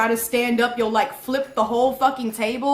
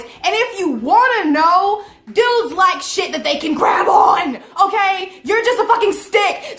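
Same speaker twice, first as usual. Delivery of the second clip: loud, raised-pitch acted tirade; indignant argument; 4 semitones higher, 6 dB louder than usual. Roommate arguing with friend about their size, loud indignant comeback. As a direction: argue back hard, re-enacting the fight.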